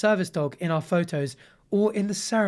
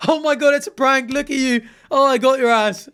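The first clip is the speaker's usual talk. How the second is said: higher, louder mock victory crow; gloating prankster; 7 semitones higher, 6 dB louder than usual. Imagined wife crowing at husband, loud mocking gloat. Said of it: "been like".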